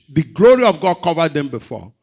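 loud, high preacher's cry; preaching loud. Acted. Preacher crying out to the church, loud and high. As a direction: drive the point home to the congregation.

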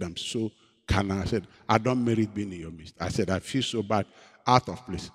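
low, soft repentant plea; tearful confession. Retold or imitated. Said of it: "I told them to forgive me".

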